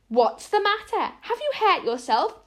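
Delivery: demanding. A narrator's reading. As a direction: demand an answer from the fallen child. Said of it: "demanded Missus Barry".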